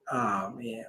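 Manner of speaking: dreading whisper. Retold or imitated. Dreading being put on the spot.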